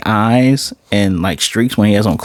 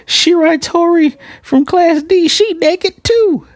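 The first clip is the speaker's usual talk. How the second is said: much higher, slow squeaky announcement; squeaky announcer voice. Retold or imitated. A reviewer mimicking a bystander naming the naked girl, high squeak. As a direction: announce her like a shocked hallway gossip. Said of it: "It was like".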